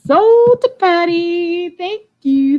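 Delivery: high, loud, long-drawn sale announcement; auctioneer's sold call. Real, not acted. Seller announces the winning bidder, calling out high and drawn-out. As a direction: sing out the winner, stretching the words.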